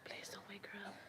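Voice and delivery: urgent hushed whisper. Real, not acted. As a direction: whisper so she stays asleep. Pleading quietly near sleeping baby.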